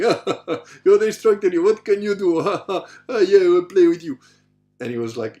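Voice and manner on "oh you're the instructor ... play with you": loud, high, laughing taunt; mocking challenge. Cocky student to his instructor, mocking. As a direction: jeer cockily at the instructor.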